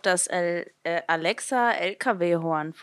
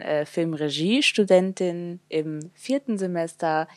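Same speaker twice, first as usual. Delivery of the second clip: ordinary talk, nothing marked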